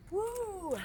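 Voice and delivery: high cheer of effort; cheering woo. Real, not acted. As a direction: whoop to rally them. Coach cheering the class.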